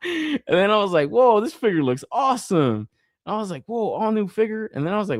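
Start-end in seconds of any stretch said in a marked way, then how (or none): 1.1-4.7 high-pitched admiring excitement; wowed self-quote. Retold or imitated. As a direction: gush over it like a thrilled fan. Re-enacting delight at figure.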